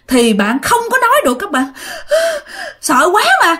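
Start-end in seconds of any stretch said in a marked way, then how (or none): 1.7-2.8 sobbing gasp. Retold or imitated. Too scared to speak.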